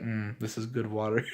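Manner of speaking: soft, savoring the taste; quiet low savoring. Retold or imitated. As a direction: murmur it like a connoisseur. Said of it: "He's like".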